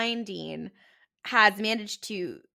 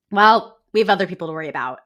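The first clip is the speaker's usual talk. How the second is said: rapid, louder dismissive quote; curt brush-off, rattled off quickly; brushing it off fast; 5 semitones lower, 7 dB louder, much faster than usual. Retold or imitated. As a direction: rush past the question to dodge it. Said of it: "he clearly does not want to talk about it".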